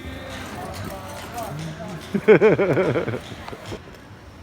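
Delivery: long high laugh that fades out; long real laugh. Real, not acted. Vlogger keeps laughing about the friendly cops on the beat, a long real laugh. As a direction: let the laugh roll on, then die away.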